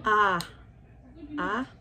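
ordinary talk, nothing marked

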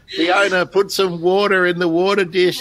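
a playful high crow voice calling Fiona; voicing the crow. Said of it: "I wonder what he's saying".

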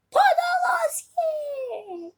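high, loud, very drawn-out name call; exultant shout. Real, not acted. Gamer hailing the goal scorer, exultant long shout. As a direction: roar the scorer's name like a commentator.

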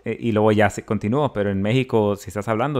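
ordinary talk, nothing marked

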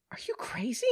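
high, whispery gasp of disbelief; appalled disbelief. Acted. Woman hearing of protective services, appalled whispery disbelief. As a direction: breathe it out high, incredulous.

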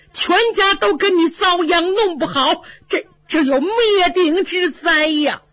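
high, crying dread of ruin; panicked father. Acted. 贾政惊恐哭喊全家将遭灭顶之灾. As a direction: cry out the fear of disaster.

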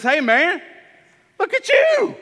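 light up at the sight of him; delighted greeting. Acted. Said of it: "Jesus found him and he says".

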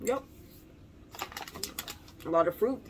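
talking with mouth full; muffled words while chewing; chewing candy. Real, not acted. Seen 0: Ring put in mouth at -3; lips pursed, cheeks working throughout.

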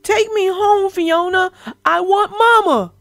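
a scared little one wanting Mama; frightened plea. Acted. Scared little brother caught in web.

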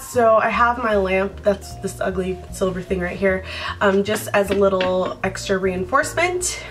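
ordinary delivery

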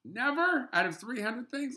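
high, loud disbelief; incredulous. Real, not acted. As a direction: challenge them in astonishment. Disbelieving challenge to colleague's answer.

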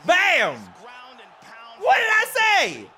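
high, loud triumphant yell; real victory yell; victory shout. Real, not acted. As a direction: shout it after the knockout. Knockout celebration.

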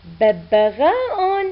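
ببغاء drawn out syllable by syllable; slow blended reading. Shown as a demonstration. Teacher blending letters into a word for children, slowly. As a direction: stretch ببغاء slowly for the class. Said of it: "أن".

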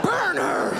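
high loud yell to burn her; mob shout. Retold or imitated. Fourteenth-century man condemning a witch, shouting. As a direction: shout it like a torch-waving mob. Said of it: "he was like".

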